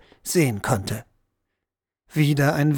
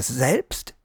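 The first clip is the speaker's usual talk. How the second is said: ordinary delivery, nothing marked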